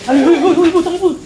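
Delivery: loud startled cries; startled yelp. Real, not acted. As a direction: yelp in sudden fright. Sudden fright in the dark.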